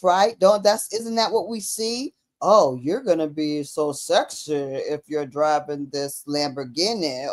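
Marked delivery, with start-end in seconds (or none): 2.4-7.3 low, smooth commercial pitch. Retold or imitated. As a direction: sell it like a sultry car ad. Mocking a sexy car ad.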